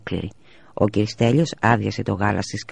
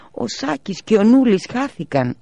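high, slow, louder lament; tearful wail; 13 semitones higher, 5 dB louder, slower than usual. A narrator's reading. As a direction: keep crying out the loss. Mother keeps wailing. (η μητέρα θρηνεί, ψηλά και αργά)

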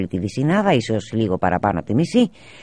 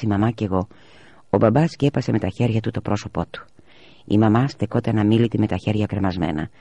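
usual speaking voice

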